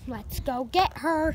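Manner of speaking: loud robot command. Pretend. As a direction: call it out loud. Robot game, louder take.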